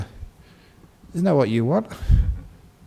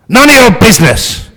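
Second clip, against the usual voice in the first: 1 rude thief shout; 10 semitones higher, 10 dB louder than usual. Acted. Burglar rebuffs the abbot.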